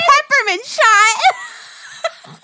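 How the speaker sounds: high, loud, stretched laugh through the words. Real, not acted. Cohost teases her friend, laughing loudly at the crying. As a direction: laugh it out while teasing.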